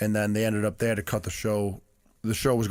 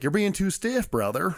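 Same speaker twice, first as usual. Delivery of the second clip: higher-pitched American hillbilly "brother" voice; hillbilly American accent; 7 semitones higher, slower than usual. Retold or imitated. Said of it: "American accent, like, with a hillbilly, like, brotherly voice".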